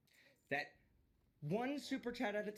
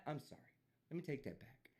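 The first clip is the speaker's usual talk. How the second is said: usual speaking voice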